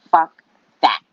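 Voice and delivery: loud, punched retort; emphatic retold dismissal; blunt refusal. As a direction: shut the offer down hard. Retelling blunt refusal to agent.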